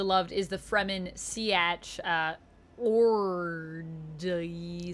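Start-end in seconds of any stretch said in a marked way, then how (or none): 2.8-4.9 very slow, stretched-out "orgy"; said weird. Shown as a demonstration. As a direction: drag each syllable out oddly to dodge the censors. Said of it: "I'm just gonna say that weird".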